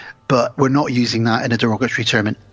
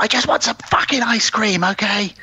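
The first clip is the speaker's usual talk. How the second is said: higher, hammed-up snap; husband snapping at his wife, mock fury; mock angry outburst; 9 semitones higher than usual. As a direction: blurt it like a cranky quitter. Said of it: "And he's like".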